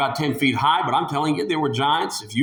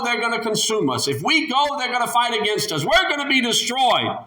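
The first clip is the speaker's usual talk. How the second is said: higher, pleading complaint of scared spies; preacher acting out the frightened spies, high and whiny; fearful spies whining; 6 semitones higher than usual. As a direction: whine that it's too hard, rising higher. Spies voiced complaining, afraid.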